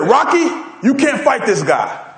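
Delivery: slow, emphatic imitation of an old coach; Mickey. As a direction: lightly act an old trainer warning his boxer. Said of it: "remember old man Mickey? Mickey said".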